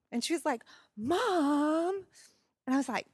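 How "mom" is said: put-on whiny teen. Daughter's whiny protest.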